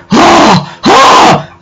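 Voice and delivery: high mock gasps of shock; two big, high imitated gasps. Everyone gasping as he lands in the pool, shocked.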